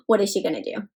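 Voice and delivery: quick hushed gasp of suspense. Pretend. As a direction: rush it out, worried for her.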